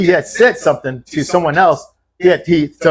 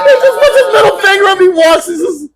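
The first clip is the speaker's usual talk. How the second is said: high, laughing telling; amused, voice lifted high with laughter; laughing retelling; 5 dB louder than usual. Real, not acted. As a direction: tell it while cracking up.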